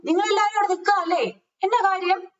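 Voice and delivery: high, quick neighbourly call; calling out a question. Acted. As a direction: act out asking the neighbours across the yard. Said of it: "എന്ന് ചോദിക്കും".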